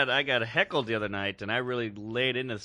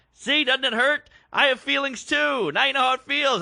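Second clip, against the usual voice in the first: loud, high, aggrieved rant; going off; 11 semitones higher, 6 dB louder than usual. Retold or imitated. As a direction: scold hecklers with wounded indignation, pitch soaring. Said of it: "And I just went off".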